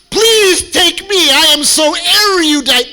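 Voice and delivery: high, loud, drawn-out mock plea; hammed-up desperate begging at full volume; shrieking eager applicant. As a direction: shriek it like an over-keen show-off. Mimics eager dictionary-loving applicant.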